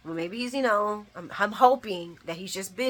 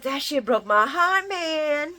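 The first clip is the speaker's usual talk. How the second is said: mock-tearful cry, higher and louder; heartbroken wail; 7 semitones higher, 5 dB louder, slower than usual. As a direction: wail it out as if crushed. Acting out her heartbroken typed reaction.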